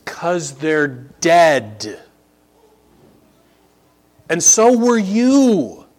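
high, loud, slow pulpit emphasis; preaching at full force. Acted. Preacher stressing the survey result to the congregation, loud and slow. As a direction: hammer home the shocking survey.

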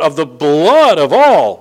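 slow, loud, weighty blood of all; a preacher's slow, loud emphasis; stress on blood of all. Shown as a demonstration. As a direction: lean hard into of the blood of all. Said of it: "this powerful, charged word".

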